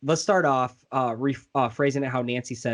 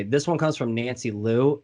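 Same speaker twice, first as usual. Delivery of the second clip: ordinary talk, nothing marked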